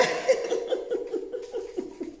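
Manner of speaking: airy laugh up high; high breathy laugh. Real, not acted. Laughing at mocking comment.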